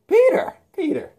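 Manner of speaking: high loud sing-song call; high loud mocking call. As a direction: call out his name teasingly. [name] calls out.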